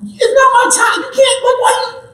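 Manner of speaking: very high, squeaky complaining; whiny son. Retold or imitated. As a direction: whine like a kid who doesn't want to go. Jesus whining to his mom.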